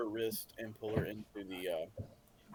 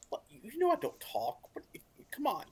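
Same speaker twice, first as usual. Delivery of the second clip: exasperated protest; 11 semitones higher, 3 dB louder than usual. Real, not acted. Player protests being put on spot.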